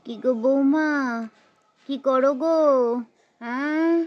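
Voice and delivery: put-on different-sounding elderly mother-in-law calling daughter-in-law; old mother-in-law. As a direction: play the old mother-in-law, asking gently. Mother-in-law voiced by same performer. (বৃদ্ধা শাশুড়ির গলায় বৌমাকে ডাকা)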